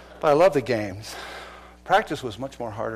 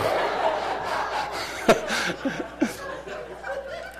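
laugh; 8 semitones higher than usual. Real, not acted. Laughs after the story.

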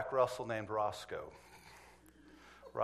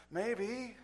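small, high-pitched, guilty maybe; comic acted-out hedging, pitched up; sheepish admission; 8 semitones higher, 3 dB quieter than usual. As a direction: squeak it out reluctantly. Reluctant admission he knows dog.

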